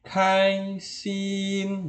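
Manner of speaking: KAI SIN slow, high and level; first tone on both. Shown as a demonstration. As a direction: stretch KAI and SIN on one high note. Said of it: "Ini juga nada pertama". (KAI SIN dua-duanya tinggi datar)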